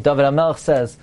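ordinary talk, nothing marked

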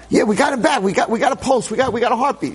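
high, rushed good news; excited nurse. Retold or imitated. Nurse telling the doctor the boy has a pulse, excited and high.

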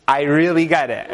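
laughing assurance. Retold or imitated.

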